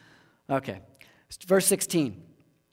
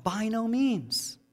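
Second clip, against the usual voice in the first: raised pitch, drawn-out exclamation; mild mock scream; 7 semitones higher, slower than usual. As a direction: cry it out without really shouting. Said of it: "he screams out".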